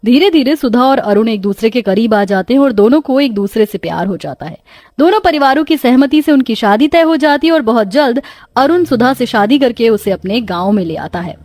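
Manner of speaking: ordinary delivery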